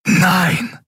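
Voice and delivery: high, sharp cry of no; a desperate cry inside his head; inner scream. A narrator's reading. Miko sees the captain targeted, high cry. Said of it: "schrie alles in Mikos Geist".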